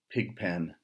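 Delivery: ordinary delivery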